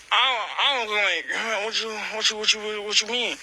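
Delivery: stumbling, repeating confusion; acted-out baffled stutter; flustered stammer. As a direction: trip over the words, lost for an answer. Stammering at mother's suggestion.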